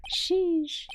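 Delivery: breathy high whispered sheesh. Real, not acted. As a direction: mutter it under your breath. Whispered aside after scolding judge.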